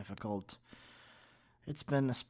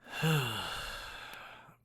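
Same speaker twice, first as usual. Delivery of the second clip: dramatic sigh. Acted. Sigh over his problems.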